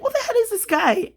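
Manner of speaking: high, fast, startled what-the-hell; shocked disbelief. Retold or imitated. Reliving the road-rage threat, she gasps in shocked disbelief. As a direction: recoil in alarm at a menacing stranger. Her reaction to threatening driver.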